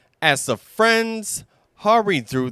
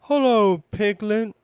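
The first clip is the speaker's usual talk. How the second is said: Pooh voice; 7 semitones higher than usual. Acted.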